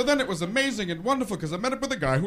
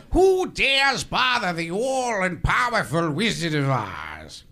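Wizard of Oz; slower than usual. Acted. Wizard challenging visitors who bother him, grand and slow. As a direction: intone it as an all-powerful ruler. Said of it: "here we go, The Wizard of Oz".

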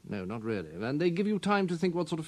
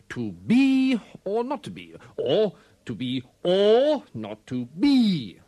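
"be" and "or" boomed far louder than the rest; one word louder; 3 semitones higher, 7 dB louder, much slower than usual. Shown as a demonstration. Actor demonstrating his craft, one word boomed louder. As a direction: stretch it slowly, blasting out the stressed "be" or "or". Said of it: "say one word louder than another".

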